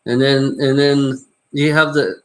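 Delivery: normal talking voice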